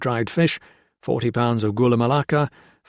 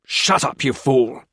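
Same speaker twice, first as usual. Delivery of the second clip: angry; 2 semitones higher than usual. A narrator's reading. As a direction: cut him off. Jones silences Blakely.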